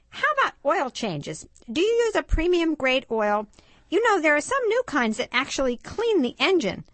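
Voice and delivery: put-on high-pitched boy Stanley; eager car-obsessed boy. Stanley tells his dad's friend about brakes, high boyish voice.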